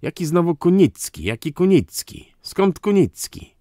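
loud, high-pitched incredulous questions; indignant yelling. A narrator's reading. Count challenges the name he just heard, yelling indignantly. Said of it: "wrzasnął hrabia".

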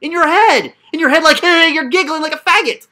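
loud, high, exasperated shouting; angry yelling. Real, not acted. Coach yelling at a student over his fake persona. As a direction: yell at him in frustration.

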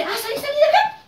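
high, fast mock-angry dismissal; annoyed brush-off. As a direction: act out an irritated relative shooing someone away. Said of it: "se enoja y le dice".

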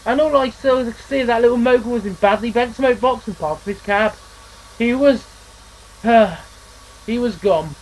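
a touch of a shaky, grieving voice; understated grief fading to nothing; mild sorrow as the story ends; voice shaking. Acted. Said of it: "His voice shook and died".

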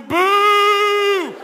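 long, loud booing howl; crowd boo. Retold or imitated. Talk show crowd booing the bad girl, loud and long. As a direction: boo like a whole angry studio crowd. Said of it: "the crowd's booing".

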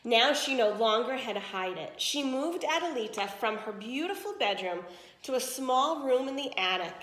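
plain reading voice

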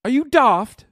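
a yelled send-up of a British chatter; mock British shout. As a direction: bellow it like a riled-up Londoner. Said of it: "kept saying daft".

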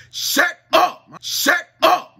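hammed-up scolding yell at the kids; screaming mom imitated; mock yelling mom. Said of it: "she was like".

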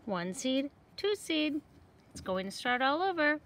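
lively picture book reading; reading to children. Acted. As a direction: read it brightly for young kids. Seeds close the story.

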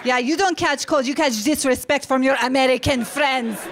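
indignant mother. Retold or imitated. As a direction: argue back like an affronted immigrant mom. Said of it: "She's like".

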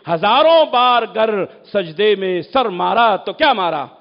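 ordinary talk

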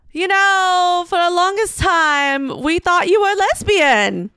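high, loud, slow mom voice on a microphone; high and loud, slow and tipsy; imitating tipsy mom. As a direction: do your drunk mother giving a toast. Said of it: "And it's my mom".

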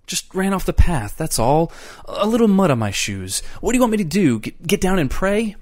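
high, loud protest; agitated self-defence. A narrator's reading. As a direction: plead your case in a rising panic. Eccles defends himself, worked up.

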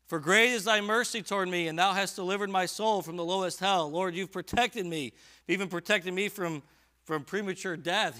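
fervent scripture proclamation; full-force preaching. Acted. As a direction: proclaim it to the congregation. Preaching climax of psalm.

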